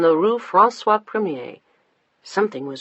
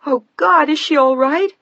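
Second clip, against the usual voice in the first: high, loud panicked plea; frightened, near tears; 10 semitones higher, 8 dB louder than usual. A narrator's reading. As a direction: fight back tears while shouting. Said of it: "desperately trying to control her voice".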